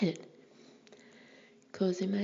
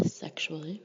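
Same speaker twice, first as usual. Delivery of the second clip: usual speaking voice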